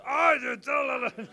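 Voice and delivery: high, loud, slow outburst; angry shout. Retold or imitated. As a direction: blow up at him. Joe yelling at the speaker.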